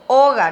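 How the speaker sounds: "oga" loud, with the O stressed. Shown as a demonstration. Teacher asks the class to repeat, stressed O. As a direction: lean on the opening O in "oga". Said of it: "lleva acento en la O".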